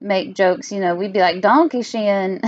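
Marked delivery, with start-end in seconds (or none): 1.4-2.4 slow, heavy mock German accent. Teasing German class, a slow mock German accent. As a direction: drag out Donkey Shin in a jokey German accent. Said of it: "Like a really strong".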